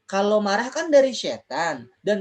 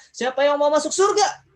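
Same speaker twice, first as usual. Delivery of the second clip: high-pitched loud rallying shout; even more spirited; 8 semitones higher, 5 dB louder than usual. Real, not acted. Teacher asking children who wants heaven, very spirited shout. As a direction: whip the crowd up, loud and high. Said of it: "lebih semangat bilang saya".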